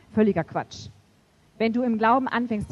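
ordinary talk, nothing marked

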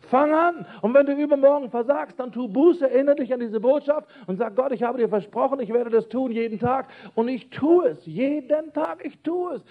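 high-pitched, urgent exhortation; impassioned vow pitched well above normal; fervent preaching. Real, not acted. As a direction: preach it with rising fervour.

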